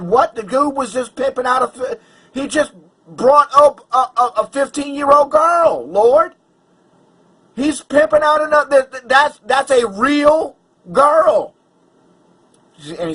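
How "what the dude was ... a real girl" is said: higher, louder, indignant outcry; outraged protest. Retold or imitated. Protesting to the Lord about the girl, higher and loud with outrage. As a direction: cry out in disbelief at the injustice.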